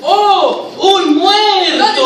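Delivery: high, drawn-out theatrical discovery; demonstrated shock. Shown as a demonstration. Director shows the policeman finding a body, exaggerated shock. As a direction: stretch it out high and loud, hammed-up astonishment. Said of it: "ves que está tirado y dices".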